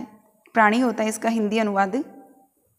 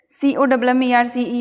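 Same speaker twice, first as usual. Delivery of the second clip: usual speaking voice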